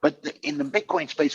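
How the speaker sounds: ordinary delivery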